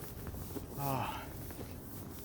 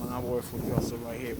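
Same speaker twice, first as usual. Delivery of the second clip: ordinary delivery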